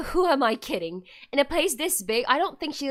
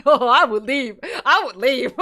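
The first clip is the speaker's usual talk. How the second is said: loud, amused outburst; real laughter breaking into the words; laughing; 10 dB louder than usual. Real, not acted. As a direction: blurt it out through big laughs. Laughing aside after reading.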